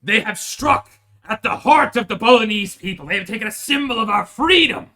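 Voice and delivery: loud, high pompous outrage; hammed-up noble indignation; mock grand speech. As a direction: declaim like an offended medieval townsman. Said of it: "They're like".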